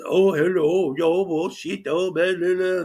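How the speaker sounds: high put-on fake Indian gibberish; mock fake Indian accent. Streamer mocking an auditor's fake Indian babble. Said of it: "he starts with his".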